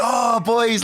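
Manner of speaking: high-pitched mock hail to the lads; imitated excited greeting. Imitating a host greeting boys at their table, excited and high. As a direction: play an effusive host spotting his friends.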